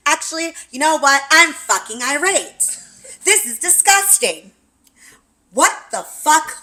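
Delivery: from a little mad to a high loud rant; building fury. Retold or imitated. Texting roommate about the cats, rising furious rant. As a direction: work yourself up into outrage. Angry text escalating to roommate.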